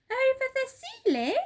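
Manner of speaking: high, loud disbelieving question; shocked at the order; surprised cry. Acted. Helpers questioning Mugglewump's plan, high surprised cry. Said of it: "they cried".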